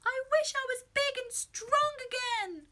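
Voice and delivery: high-pitched shout at the top of the voice; shouted wish. A narrator's reading. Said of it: "He shouted at the top of his voice".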